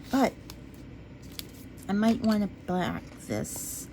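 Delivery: ordinary delivery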